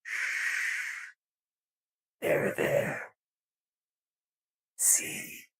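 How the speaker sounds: hushed whisper through the filter. Acted. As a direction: whisper so nobody wakes up. Hushing, someone is sleeping.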